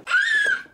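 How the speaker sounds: sudden high loud cry; falling scream. Acted. Barbie crying out as she tumbles, high shriek. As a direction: scream as you fall.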